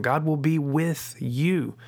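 "with" and "you" each stretched and stressed; slow down and land separately on "with", then "you"; weight thrown onto the closing "with you". Shown as a demonstration. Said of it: "no matter what word you put emphasis on".

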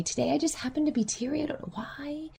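fast, soft half-whisper; whispered admission of tears; teary whisper. Pretend. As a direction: confide it under your breath, fast. Imitating a teary client.

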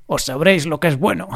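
hammed-up tough fighter voice. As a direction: play the action-movie hero threatening. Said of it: "puse mi voz de luchador". (voz de luchador exagerada)

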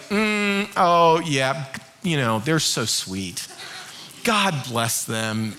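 low, slow, unimpressed murmur; patronizing indulgence. Acted. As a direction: humor them with slow, condescending pity. Unimpressed spouse's inward reaction acted.

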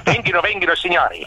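fairground barker. Acted. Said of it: "nelle fiere".

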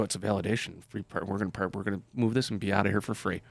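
smug hushed reply. Retold or imitated. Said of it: "And I go".

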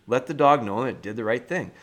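ordinary talk

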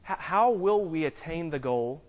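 ordinary delivery

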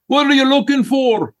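high, bright-voiced Bahamian shop guy greeting; Bahamian accent. Health food store guy from the Bahamas greets the chef in a high Bahamian accent. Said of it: "guy from the Bahamas".

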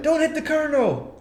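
high-pitched movie-quote yell; mock panicked shout. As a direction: call it out high like a frantic warning. Quoting panicked movie order.

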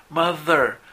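"mother" said with a clear voiced th; voiced th demo. Shown as a demonstration. Teacher models the voiced th in mother. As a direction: buzz the th in "mother" with the tongue between the teeth. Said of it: "en inglés es mother".

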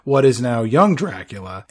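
normal talking voice

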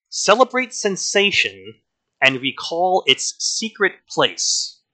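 a hint of Peter Wyngarde's slow lounge delivery; slow seductive reading. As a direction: draw it out like a crooner seducing. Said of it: "the way that he says it with that awesome Peter Wyngarde way".

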